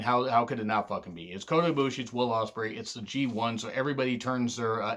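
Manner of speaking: ordinary talk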